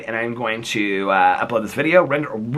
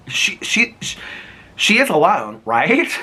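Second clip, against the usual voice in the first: stammering spooky emphasis; 4 semitones higher, slower than usual. Acted. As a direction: build the suspense. Builds dramatic read.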